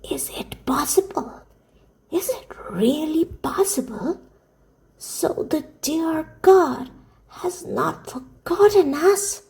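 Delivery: put-on slow, high, whispery old woman's voice; amazed old grandmother. A narrator's reading. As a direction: exclaim in hushed wonder as an old woman. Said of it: "exclaimed the grandmother".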